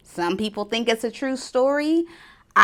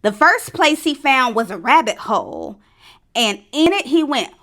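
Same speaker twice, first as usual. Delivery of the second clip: usual speaking voice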